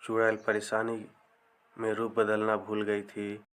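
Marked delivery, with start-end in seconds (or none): none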